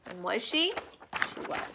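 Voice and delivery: ordinary delivery, nothing marked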